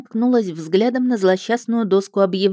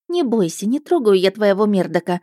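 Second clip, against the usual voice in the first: usual speaking voice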